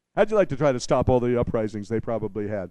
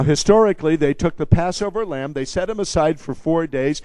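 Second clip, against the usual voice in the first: ordinary delivery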